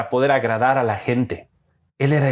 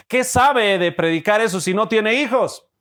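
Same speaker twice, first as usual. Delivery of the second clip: higher-pitched imitation of complaining churchgoers; mock criticism; 7 semitones higher than usual. Churchgoers question the childless pastor, mock indignant complaint. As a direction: voice a congregation's objection, pitched up. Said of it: "cuando se casó dijeron".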